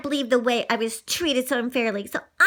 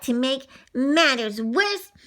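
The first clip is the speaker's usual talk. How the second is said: usual speaking voice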